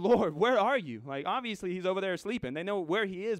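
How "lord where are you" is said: loud, higher-pitched plea; desperate calling out. Acted. Disciples crying out to sleeping Jesus, loud desperate call. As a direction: call out urgently for help.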